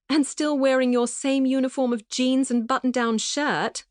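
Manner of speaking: affectionate teasing in a proper English voice; prim British friend. A narrator's reading. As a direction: gush over an old friend in a prim British way. Arabella voiced by narrator.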